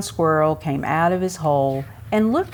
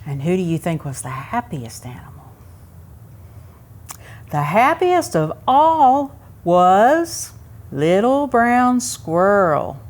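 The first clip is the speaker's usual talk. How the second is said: ordinary delivery, nothing marked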